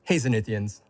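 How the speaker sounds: ordinary delivery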